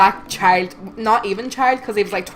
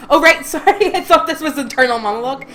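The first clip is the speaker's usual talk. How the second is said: embarrassed laugh; 6 semitones higher, 5 dB louder than usual. Real, not acted. Catches own mistake, laughing.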